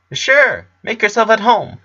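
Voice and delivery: put-on high, eager boy's voice; excited. A narrator's reading. Clyde greeting his missing best friend, excited and high. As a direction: burst out with glad surprise.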